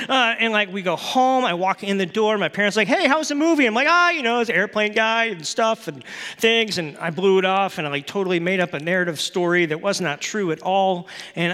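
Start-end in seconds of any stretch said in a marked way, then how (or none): 2.9-3.7 much higher, louder sing-song question; bright parental greeting; cheery parent voice. Retold or imitated. Parents asking retold. 3.9-6.8 high, breezy, brushing it off; casual cover-up lie; teen lying to parents, nervously breezy; nervous evasive answer. Retold or imitated.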